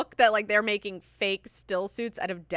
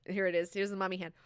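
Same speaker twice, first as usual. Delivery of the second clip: plain reading voice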